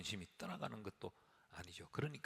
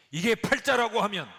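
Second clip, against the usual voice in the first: high loud preaching cry; a preacher crying out at full force; loud exclamation; 11 semitones higher, 18 dB louder than usual. Real, not acted. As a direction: belt it out to the congregation.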